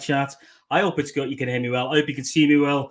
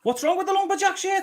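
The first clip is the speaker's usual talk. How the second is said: mock offended; 3 dB quieter than usual. Real, not acted. Defending his shirt.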